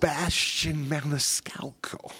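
a soft breathy whisper of the name. Real, not acted.

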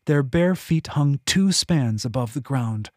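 usual speaking voice

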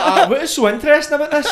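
high, loud, incredulous retelling; loudly puzzled at your own story; mock bafflement. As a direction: act baffled, pitched well up with laughter in it. Baffled self-quote over laughter.

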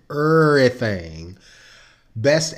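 slow Saint Louis everything; st louis accent. Shown as a demonstration. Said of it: "I'm using my Saint Louis accent".

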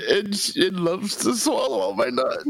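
very high voice, choked by real laughing; helpless with laughter. Real, not acted. Poet refusing to hand over the rhyme, helpless with laughter. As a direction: force the last words out through giggles. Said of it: "You can't read it without laughing".

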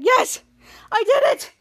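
high, loud acted triumph; happy cheering. So happy at the news, high triumphant cheer. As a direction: cheer it out in short bursts. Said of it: "so happy, he's like".

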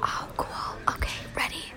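soft, voiceless whisper into the mic; hushed playful ASMR talk. Pretend. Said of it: "let's just do some A S M R talking".

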